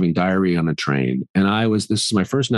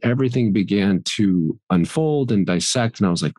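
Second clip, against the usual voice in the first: ordinary talk, nothing marked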